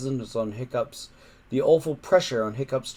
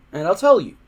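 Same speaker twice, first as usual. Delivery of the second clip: urgent; 6 semitones higher, 8 dB louder, faster than usual. Acted. Hiccup bargaining loudly.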